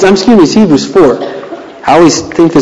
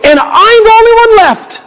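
Elijah's lament. Retold or imitated.

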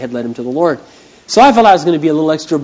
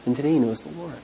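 usual speaking voice

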